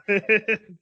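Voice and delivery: normal talking voice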